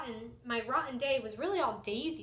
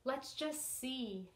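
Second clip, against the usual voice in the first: soft, slow gentle reply; a patient grandfather's murmur; calm grandfather; 8 dB quieter, slower than usual. Acted. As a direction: reassure him quietly. Grandfather calmly answering.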